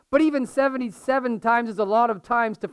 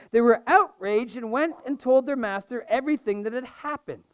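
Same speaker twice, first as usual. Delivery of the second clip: measured reading of the parable; scripture reading; 4 semitones higher than usual. Acted. Pastor reading scripture to his church, measured and clear. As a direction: read it out to the congregation.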